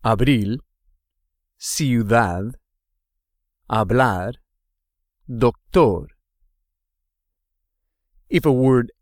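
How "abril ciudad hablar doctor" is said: "Abril", "ciudad", "hablar", "doctor", each stressed on its final syllable; "Abril", "ciudad", "hablar", "doctor" spaced apart, endings emphasized; stress on last syllable. Shown as a demonstration. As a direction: lean on BRIL, DAD, BLAR, TOR in "Abril", "ciudad", "hablar", "doctor". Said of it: "is on the last syllable".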